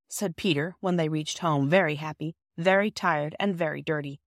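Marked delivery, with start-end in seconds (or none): none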